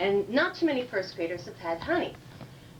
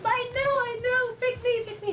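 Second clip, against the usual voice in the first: high, louder schoolgirl squeal of eagerness; eager little girl; 5 dB louder than usual. Acted. Schoolgirl begging teacher to pick her, high and eager. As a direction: plead to be picked, high and urgent. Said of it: "First little girl, the first one goes".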